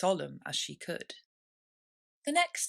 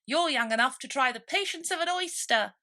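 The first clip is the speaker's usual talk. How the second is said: snappish; 8 semitones higher, 7 dB louder than usual. A narrator's reading. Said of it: "a little snappishly".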